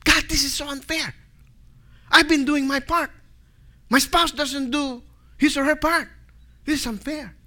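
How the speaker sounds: high-pitched mock complaint; debating with God. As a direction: whine to God about being wronged. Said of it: "how we debate with God".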